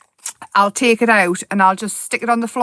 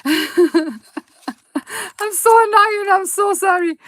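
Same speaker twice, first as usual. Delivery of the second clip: can't stop giggling while apologising; real giggly fit of excitement; giggling apology; 10 semitones higher, slower than usual. Real, not acted. Giggling apology over her excitement.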